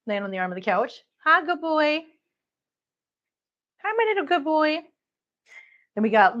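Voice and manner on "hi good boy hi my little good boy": high sing-song cooing at a cat. Real, not acted. Owner greeting her cat, in high baby talk. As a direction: coo it up high like greeting a pet.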